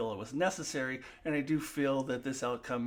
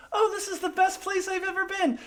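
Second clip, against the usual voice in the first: much higher, syrupy mock praise of the ship; mock gushing; 4 dB louder than usual. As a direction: gush it like a fake-sweet Mariner. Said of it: "she goes and tells them".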